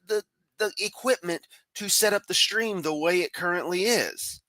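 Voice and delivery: high, sarcastic disbelief. Real, not acted. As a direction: lay the sarcasm on thick. Sarcastic rebuttal to Kent.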